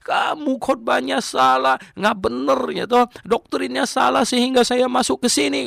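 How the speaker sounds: high, loud accusing yell at the pastor; furious at the preacher who misled him; angry outburst. Retold or imitated. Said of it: "Wah, dia lebih marah lagi". (teriakan marah yang tinggi dan keras)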